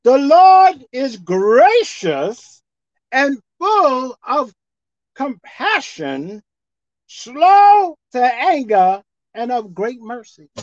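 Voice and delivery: very slow, every word drawn out; slow reading. Shown as a demonstration. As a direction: read the verse slowly, weighing each word. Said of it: "Let's read it slowly again".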